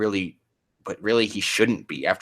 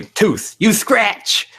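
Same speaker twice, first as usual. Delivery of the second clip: high, loud trainer call; hammed-up trainer shout; shouted battle command; 14 semitones higher, 5 dB louder, much slower than usual. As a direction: yell the attack order at the Pokemon. Re-reading battle command, acted out.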